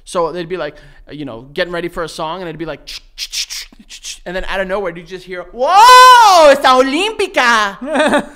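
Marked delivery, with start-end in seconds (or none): 2.9-4.2 whispered ch-ch beat. Retold or imitated. Imitating radio jingle beat. 5.4-8.3 much higher, much louder, drawn-out radio DJ shout; hyped radio announcer. Retold or imitated. Imitating the radio host's jingle to his friend, a loud hyped shout.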